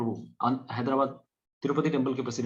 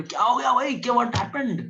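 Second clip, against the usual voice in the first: much higher, surprised asking; surprised friend; 9 semitones higher, 3 dB louder than usual. Retold or imitated. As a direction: jump up in surprise. Acting the startled friend. (हैरान दोस्त, ऊँची आवाज़ में)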